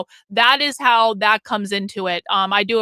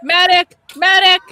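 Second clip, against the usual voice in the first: shouting high and loud for a medic; man down shout; 7 semitones higher, 7 dB louder than usual. Retold or imitated. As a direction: call out urgently, as if someone collapsed. Echoing the rally's call for a medic.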